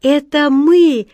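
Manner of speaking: loud, high, drawn-out hail; calling back. A narrator's reading. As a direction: call out in answer, stretching it. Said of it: "откликнулся голубоглазый тельняшка".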